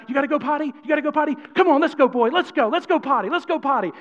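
high, hyped-up dog-training voice; excitedly coaxing a dog; extra excited. Acted. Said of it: "you gotta get extra excited".

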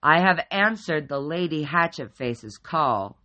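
loud, booming formal pronouncement; loud booming teddy bear. A narrator's reading. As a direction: boom it so it shakes the room. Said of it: "he boomed out in a voice so loud".

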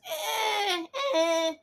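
high, drawn-out "ehh" of doubt; doubtful "eh". Real, not acted. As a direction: squeal a so-so noise. Doubtful noise judging police competence.

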